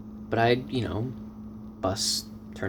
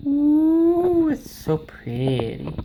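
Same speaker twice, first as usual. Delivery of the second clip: admiring; 7 dB louder, much slower than usual. Real, not acted. Admiring new arrival.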